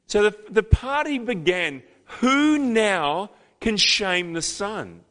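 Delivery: full-force preaching. Acted. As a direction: preach it out, climbing with joy.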